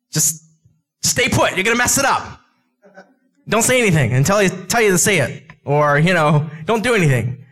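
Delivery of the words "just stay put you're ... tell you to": high, half-shouted warning; hammed-up stern God; mock scolding. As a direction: tell them off to keep still. God voiced scolding the believer.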